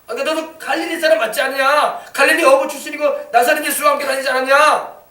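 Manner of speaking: high, loud accusatory questioning; accusing challenge. Acted. Bystanders confronting Peter about his Galilean origins, accusing.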